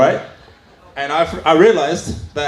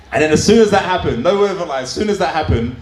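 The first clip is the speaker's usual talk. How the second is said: ordinary delivery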